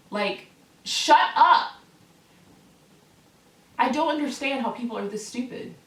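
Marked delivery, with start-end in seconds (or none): 0.8-1.7 loud, high, stretched-out words; exasperated shout. Real, not acted. Fed up with complainers, exasperated shout. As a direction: yell it slowly, fed up.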